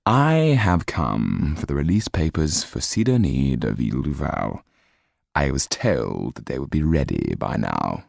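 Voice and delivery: low clipped-free steady gentleman's demand; cold demand. Acted. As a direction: press him, icy and measured. Kaliowski demands papers.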